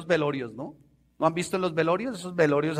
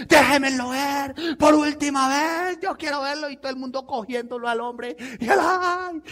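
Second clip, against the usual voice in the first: mock mourner; 9 semitones higher than usual. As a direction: overact the hypocrite at the coffin. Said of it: "cuando se mueren". (doliente hipócrita exagerado, muy agudo)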